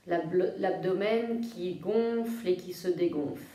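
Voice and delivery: soft, low-key yoga nidra voice with long pauses; guided meditation. Real, not acted. Yoga teacher guiding listeners to feel their breathing, calm meditation voice. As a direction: guide them gently into rest, phrase by phrase.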